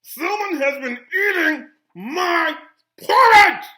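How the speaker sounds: slow, booming, high-pitched bear roar; outraged big bear, loud and drawn out; great big Papa Bear voice. A narrator's reading. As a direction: build to a huge shout on the last word. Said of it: "in his great big voice".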